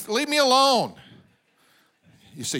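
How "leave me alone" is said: higher, mock-fed-up cry; hammed-up plea to a pestering voice; exasperated outburst. Preacher answering the voice that drives him crazy, mock exasperation. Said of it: "Drives me crazy".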